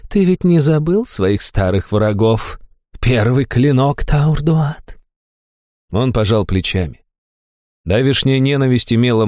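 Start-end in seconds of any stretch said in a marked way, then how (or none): 0.1-5.0 higher, slow gloating temptress; gloating demoness. A narrator's reading. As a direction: savour each word, gloating. Demoness taunting old enemies.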